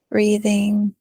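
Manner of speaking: ordinary talk, nothing marked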